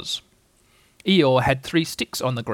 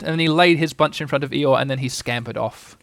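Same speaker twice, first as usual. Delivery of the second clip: plain reading voice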